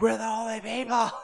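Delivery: hammed-up indignant call; high mock shout at the street; mock yell. [name] yelling at empty street.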